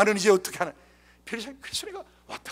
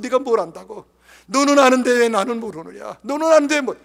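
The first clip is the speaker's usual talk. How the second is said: loud, high, impassioned lament; impassioned outburst of shame; anguished self-reproach; 3 semitones higher, 8 dB louder than usual. Retold or imitated. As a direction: cry out the question in reproach. Anguished self-reproach before son's faith.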